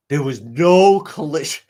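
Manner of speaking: loud put-on voice; mocking imitation; mock defense expert. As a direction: ham up the expert's claim.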